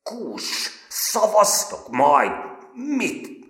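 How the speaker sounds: trembling with anger, choking on the words; furious, stammering outburst; stammering fury. A narrator's reading. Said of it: "dadogva káromkodik ránk".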